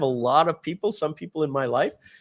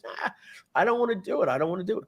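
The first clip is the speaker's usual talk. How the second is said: reluctant brother; 6 semitones higher, faster than usual. Retold or imitated. Brother refusing in retold scene.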